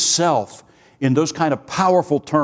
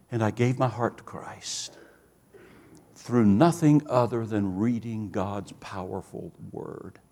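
hushed, solemn close; 5 semitones lower, 7 dB quieter than usual. Retold or imitated. Convert ending his testimony at the conference, hushed and solemn. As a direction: drop much softer and lower.

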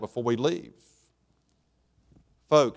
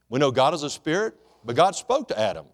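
ordinary talk

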